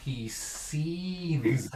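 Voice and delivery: soft, lingering stress on SEETHES; seething. Acted. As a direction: stretch SEETHES out under your breath. Repeats dad's quiet anger.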